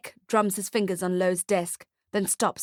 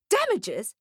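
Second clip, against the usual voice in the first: an outraged spat-back echo; 8 semitones higher than usual. Acted. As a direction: spit the word out, pitched up high. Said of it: "spits Nick".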